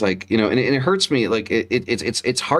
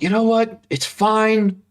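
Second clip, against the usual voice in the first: forced calm; 12 semitones higher than usual. Retold or imitated. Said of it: "I'm like".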